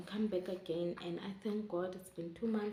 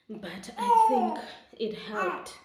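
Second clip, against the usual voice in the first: laughing through the words, higher and louder; 11 semitones higher, 9 dB louder, slower than usual. Real, not acted. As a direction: giggle it out as you say it. Laughing while retelling breastfeeding.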